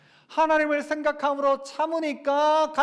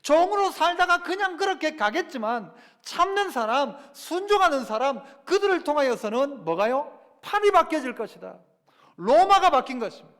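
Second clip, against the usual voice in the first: higher-pitched preaching push; rising preaching; 4 semitones higher, 4 dB louder than usual. Acted. Pastor urging the congregation toward the climax, higher preaching. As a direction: preach it with building conviction, higher.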